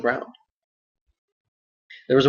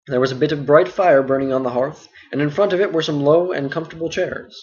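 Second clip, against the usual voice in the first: ordinary delivery, nothing marked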